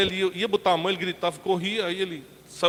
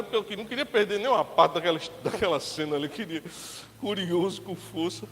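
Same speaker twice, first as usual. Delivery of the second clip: normal talking voice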